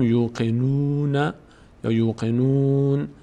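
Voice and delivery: یوقنون with a held "yuu" at the start; drawn-out "yuu-qi-nuun" for یوقنون; long "oo" sound. Shown as a demonstration. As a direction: chant یوقنون slowly, lengthening the first vowel.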